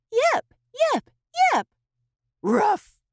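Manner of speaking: high squeaky puppy yips. A narrator's reading. Puppies showing off their yip. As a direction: yip like a tiny excited pup.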